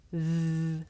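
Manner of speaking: Th. with tongue tip between the teeth; th sound. Shown as a demonstration. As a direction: show Th. as a slow held buzz. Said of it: "The sound comes from between my tongue and my top teeth".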